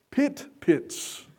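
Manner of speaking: pit pits said high with a laugh; amused. Shown as a demonstration. Preacher amused by the Hebrew, laughing. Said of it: "they are called".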